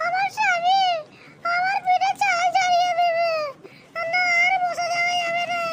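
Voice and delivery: frightened wife pleading with her lover; panicky wife voice. Acted. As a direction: play the caught-out wife, high and fretting. Wife voice again.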